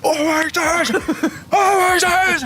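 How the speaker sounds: high, stretched coach yell; shouting coach. Retold or imitated. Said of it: "coach was shouting".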